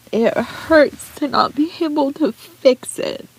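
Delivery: high, tearful voice; pained, breaking voice; crying. Real, not acted. Vlogger to her viewers, tearful. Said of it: "will not apologize for crying".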